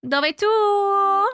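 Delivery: high singsong call; lilting, held-out call. Real, not acted. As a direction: stretch it out long and high, like calling someone in. Calling out for missing Heather.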